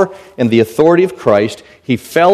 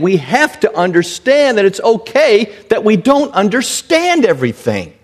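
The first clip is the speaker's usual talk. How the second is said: ordinary delivery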